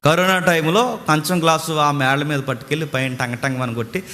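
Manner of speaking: normal talking voice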